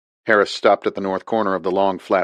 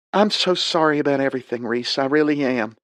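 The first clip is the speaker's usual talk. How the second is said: higher southern woman, sincere apology; higher voiced Liz apologizing to Reese; female voice, sorry; 6 semitones higher than usual. A narrator's reading.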